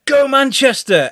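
drawn-out, high-pitched football chant; mock fan cheer. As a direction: call it out like a fan walking into a pub. Acting out cheer in a pub.

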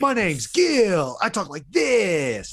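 very high, slow caricature voice; mock your friend with a slow, high-pitched voice; mocking Gil impression. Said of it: "that's what you sound like".

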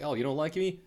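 defiant retort. Retold or imitated. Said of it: "he was out there being like".

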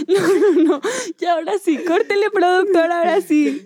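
high giggly voice through laughter; trying not to laugh; stifled giggles. Real, not acted. Can't stop laughing.

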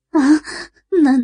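带哭腔，尾音拖长拔高; 害怕得哭出声. Acted. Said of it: "张凯丽哭着". (哭哭啼啼地诉说害怕)

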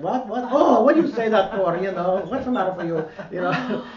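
high, loud hammed-up Italian accent, indignant; Italian grandmother accent. Grandson teasing her, grandmother answers in a loud Italian accent. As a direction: play the puzzled old Italian grandmother, way up and loud. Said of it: "my Italian grandmother from Italy".

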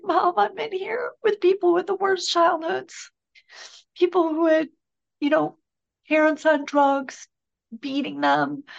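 high, choked-up voice of her son crying on the phone; retold crying. Son calling mother from jail, tearful and high. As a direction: let the voice rise and tighten as if fighting tears. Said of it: "and then he starts crying".

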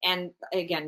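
ordinary talk

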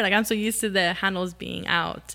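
ordinary talk, nothing marked

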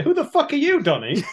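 incredulous, leaping high in pitch; baffled outburst. Retold or imitated. Acts out baffled reaction to character.

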